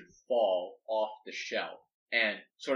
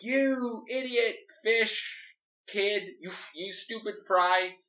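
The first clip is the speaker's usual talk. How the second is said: high, slow, snarling insult; high slow scornful thief voice; angry Shark; 9 semitones higher, slower than usual. Acted. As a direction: sneer it down at the kid. Shark yells at Doll.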